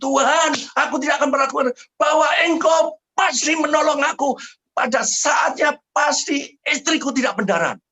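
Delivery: very high, loud cry to God, held on one pitch; acted wailing cry. Husband crying out to God for his bleeding wife, wailing shout. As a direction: shout the retold prayer like a desperate wail. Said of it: "nuangis sekeras-kerasnya, saya mulai bilang".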